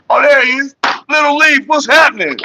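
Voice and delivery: high, loud hollered welcome; excited greeting. Real, not acted. Streamer hailing Little Leaf as he turns up, excited holler. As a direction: call out happily as your friend shows up.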